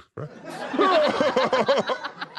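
long, high cackle; sustained high put-on laugh; performed laugh. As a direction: cackle at length, inviting others to join. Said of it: "Laugh with me right now".

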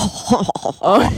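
little chuckling chortle. Shown as a demonstration. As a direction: demo a chortle. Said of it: "It's like".

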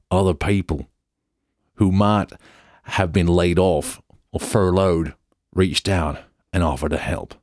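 put-on American accent, punched out phrase by phrase; hammed-up American accent with deliberate gaps. Host reading the founder's appeal for helpers, put-on American accent. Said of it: "I'm gonna do an American accent".

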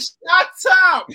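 loud sudden shout. Real, not acted. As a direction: yell it to cut them off. Reacting to rival fans' claims.

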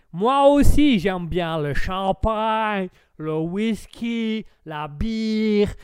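slow, hammed-up American drawl; American accent. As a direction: play the expansive American, stretching words. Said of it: "Et vous, l'Américain?". (accent américain caricatural, traînant)

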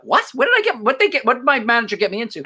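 high-pitched alarmed questions; incredulous, voice jumping up; retold disbelief. Startled retort to trespassing warning.

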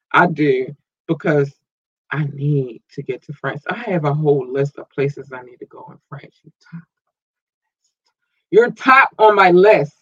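ordinary talk

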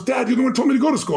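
a hint of the daughter throwing it back at him; imitating daughter objecting.

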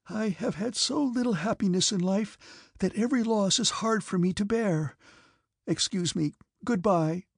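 high, plaintive, tearful voice; on the verge of crying. A narrator's reading. Princess hurrying away from the count, about to cry. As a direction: plead sorrowfully as the tears come. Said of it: "suddenly she began to cry".

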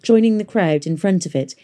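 usual speaking voice

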